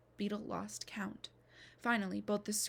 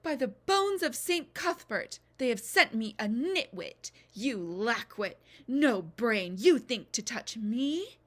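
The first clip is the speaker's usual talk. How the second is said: screeching; 9 dB louder than usual. Acted. Furious mother-to-be screeches insults at Beetle, loud and slow. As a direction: screech every insult at her. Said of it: "Screeching still".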